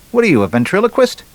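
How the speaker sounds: high, quick joke bartender; a lightly voiced barman, incredulous; punchline bartender asking fast.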